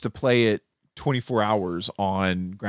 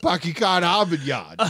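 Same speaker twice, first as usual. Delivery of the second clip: put-on higher-pitched Boston accent; 5 semitones higher than usual. Cracking up co-host, exaggerated Boston accent. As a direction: drop the R's like a Bostonian. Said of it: "so good at a Boston accent".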